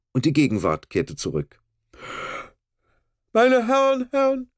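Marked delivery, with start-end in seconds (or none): 3.3-4.5 high, slow, self-important announcing; pompous. A narrator's reading. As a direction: puff up and proclaim grandly. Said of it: "rief der Erzkanzler von Bratzneck wichtig tuerisch".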